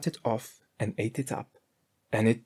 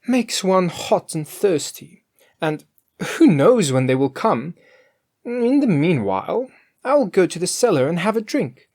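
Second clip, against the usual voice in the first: Grethel's voice; 7 semitones higher, 5 dB louder than usual. A narrator's reading.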